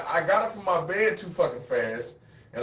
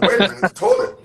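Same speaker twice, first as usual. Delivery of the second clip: mock realization; 4 semitones higher, 7 dB louder, faster than usual. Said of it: "I said".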